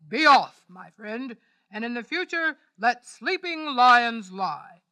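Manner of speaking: loud, grand lion; lion dismissing the mouse, loud. A narrator's reading. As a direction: send him off grandly.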